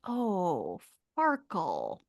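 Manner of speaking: dismayed realisation. Retold or imitated. Acting out her dismayed reaction.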